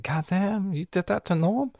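high-pitched mock-hurt disbelief; retold hurt. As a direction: pitch it up, acting wounded. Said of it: "I was, I was hurt".

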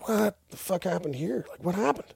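high, soft disbelief; imitating a stunned question; retold shock. Shocked at blood outside bar.

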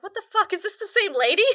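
the viewer spotting the same actress, thrown; shocked. Retold or imitated. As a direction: react like you can't believe it. Retelling own shock at the twin.

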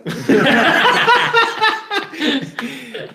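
a long, high burst of real laughter. Real, not acted. Cohost laughing at the joke about counting French. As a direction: crack up at the question.

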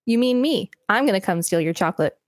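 ordinary delivery

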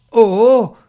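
loud interrupting cry; wife cuts in, crying out loud. Acted. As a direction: cut him off with a loud cry. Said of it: "gridò Arabella interrompendolo".

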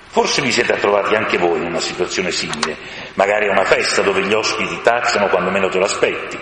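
ordinary delivery, nothing marked

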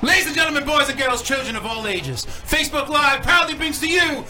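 loud, high ring announcer call; grand entrance announcement. Acted. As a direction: announce it like a wrestling host. Wrestling-style show announcement.

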